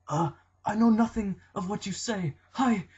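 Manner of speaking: high, loud put-on voice, playing dumb; goofy evasive voice. Wrestler dodging a fan with a high silly voice. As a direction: squeak out a nonsense dodge and bolt. Curry dodging the fan's question.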